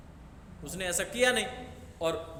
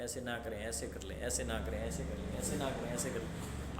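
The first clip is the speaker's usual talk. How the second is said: gentle advice; 7 semitones lower, 7 dB quieter than usual. Retold or imitated. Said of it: "उसको सलाह दो".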